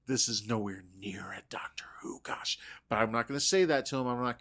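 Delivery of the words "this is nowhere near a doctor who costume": hushed, soft inner thought; whispered aside. Retold or imitated. Prop maker judging a costume, whispered. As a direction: whisper it under your breath. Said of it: "started going".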